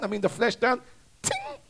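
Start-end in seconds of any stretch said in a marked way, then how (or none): 1.2-1.6 very high little "ting"; imitated sting sound. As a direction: make a tiny high-pitched sting noise.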